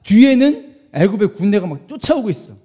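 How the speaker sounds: ordinary delivery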